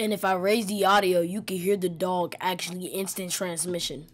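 voiceover commentary. Real, not acted.

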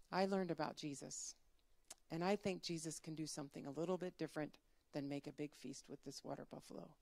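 low, soft thinking aloud; quiet pondering. Acted. Farmer reconsiders the feast, quietly pondering.

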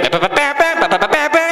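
high, rhythmic honking noises; imitated rickshaw noise. Comic arriving for his date by rickshaw, imitated honking. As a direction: toot it out like a little horn.

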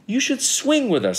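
high, bouncy monkey voice; eager invitation. A narrator's reading. Monkeys inviting Jim to swing, eager.

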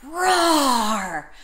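long, drawn-out mock roar; dinosaur roar. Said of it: "how about roar again".

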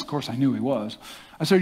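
ordinary delivery

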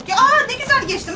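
high, loud burst of relief; excited relief. Real, not acted. Gamer cheering after slipping past the monster, excited.

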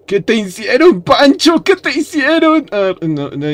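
high-pitched mock wail; lamento exagerado. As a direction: wail it high, hammed-up dismay. Mock grief over a game character. (lamento agudo y teatral)

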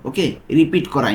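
ordinary talk

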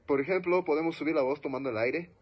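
ordinary delivery

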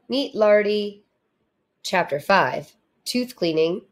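ordinary delivery, nothing marked

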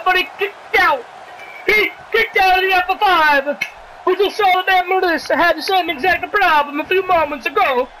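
ordinary talk

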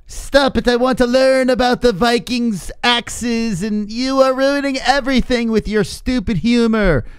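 put-on high, slow nagging child; whiny kid voice. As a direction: whine like a bratty kid. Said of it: "twelve-year-olds in the comment section that say".

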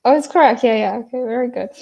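usual speaking voice

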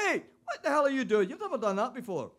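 high, loud outburst of disbelief; outraged shout. Acted. Owner catches his talking dog in the gutter, outraged shout. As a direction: yell in shocked indignation.

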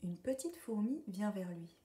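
plain reading voice

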